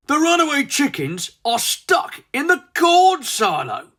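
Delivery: high, loud, voiced guard; guard's report. A guard rushing in to report to Tweedy, high and loud.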